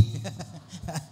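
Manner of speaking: short real laugh under the breath; quick quiet chuckle. Real, not acted. Chuckles at own remark on preaching again.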